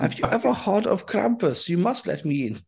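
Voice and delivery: pleading mock German accent; [name]'s German accent. Invoking Krampus to get let in, mock German accent. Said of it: "not as inappropriate as his German accent".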